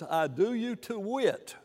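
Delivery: slow, weighty King James quote; quoting scripture slowly. Retold or imitated. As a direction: read "I do you to wit" slowly like an old text. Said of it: "Paul says".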